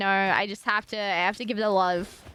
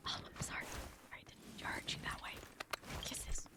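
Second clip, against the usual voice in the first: hushed breathy apology; playful whispered sorry; 20 dB quieter than usual. Pretend. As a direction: whisper it close to the mic. Soft apology to chat.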